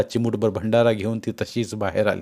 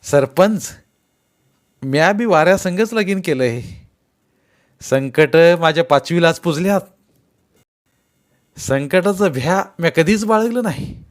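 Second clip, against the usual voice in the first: higher, louder, ringing reply; confident, cheerful groom; 6 semitones higher, 5 dB louder than usual. A narrator's reading. Govinda reassuring the sarpanch, bold cheerful reply. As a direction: answer boldly, untroubled by hardship. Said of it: "Govinda हसून म्हणाला".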